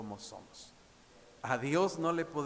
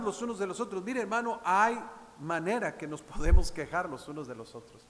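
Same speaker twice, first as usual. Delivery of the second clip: predicación enérgica; 5 semitones higher, 8 dB louder than usual. Acted. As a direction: preach it loud and full, pulpit force. Preaching to the congregation. (predicador a la congregación, voz fuerte y enérgica)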